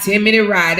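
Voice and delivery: usual speaking voice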